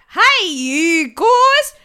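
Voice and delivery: terrible Australian mogul voice, loud, high, dragged out; Rupert Murdoch. Retold or imitated. Podcaster mocking a terrible movie voice, loud slow caricature. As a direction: bellow a slow, hammed-up bad impersonation. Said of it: "there's a terrible, uh, Rupert Murdoch".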